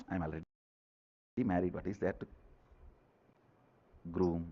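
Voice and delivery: low, very soft imitated excuse; mock resignation. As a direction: play someone who no longer bothers, quietly. Repeats the married person's excuse.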